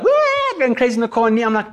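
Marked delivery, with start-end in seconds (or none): 0.0-0.6 very high whooping cry; imitated wild whoop. Boys going crazy in the car, high whoop. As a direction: mimic excited kids in a car. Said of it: "boys are like".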